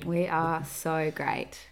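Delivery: slow, monotone self-praise; deadpan monotone. Pretend. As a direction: boast with all the excitement drained out. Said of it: "Like my monotone".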